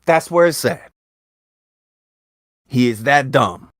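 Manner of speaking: usual speaking voice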